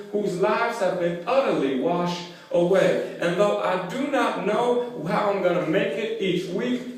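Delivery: measured preaching cadence building in pitch; rhythmic pulpit delivery, steadily mounting; sermon recitation. Real, not acted. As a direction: preach a litany of hardships, gathering intensity. Preaches from scripture.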